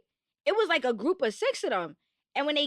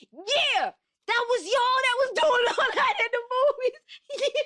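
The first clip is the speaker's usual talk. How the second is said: much higher, louder, laughing taunt; laughing mock taunt; 10 semitones higher, 3 dB louder than usual. Imitating friend taunting boys from the car, laughing. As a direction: imitate her calling them out, cracking up. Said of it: "she like".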